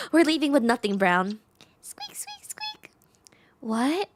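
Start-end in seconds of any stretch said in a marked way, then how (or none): 1.8-2.9 very high, soft squeaks; mouse squeak. Acted. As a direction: chirp it like a tiny mouse. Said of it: "voice acting the, the mouse".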